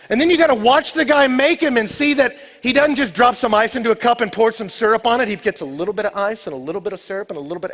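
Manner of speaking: loud, high-pitched pulpit preaching; full-force preaching. Acted. Preacher urging church to go taste, loud preaching. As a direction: drive it home to the whole congregation. Preaches snow cone story loudly.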